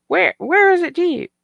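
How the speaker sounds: high, loud, puzzled question. Real, not acted. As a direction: ask it baffled.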